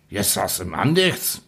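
ordinary delivery, nothing marked

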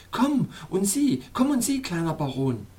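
Snowflower calling. Acted. Princess calls the little baron to come, high eager call. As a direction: call him to come along, high and bright. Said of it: "rief Schneeblume".

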